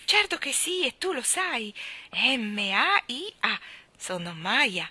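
very high, loud delighted exclaiming. A narrator's reading. Maya answering Mary Poppins, high and delighted. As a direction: spell her name hopping with joy. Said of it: "saltellò su una gamba sola, deliziata".

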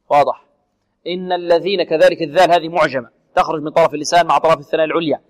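ordinary talk, nothing marked

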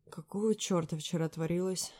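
usual speaking voice

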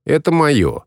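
put-on high, stubborn child's voice; little girl defiant. A narrator's reading. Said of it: "ответила она".

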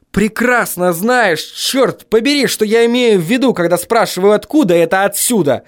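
high, heated insistence; high-pitched irritated tirade; exasperated. A narrator's reading. Acted angry rant into phone.

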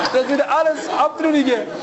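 words spilling through a laugh; amused laugh at the man's words. Real, not acted.

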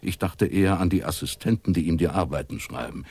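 usual speaking voice

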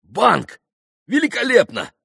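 loud, high delight; eager exclamation. A narrator's reading. Mitya taking up the card game, exclaiming eagerly. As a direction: seize on the idea loudly. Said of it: "подхватил Митя".

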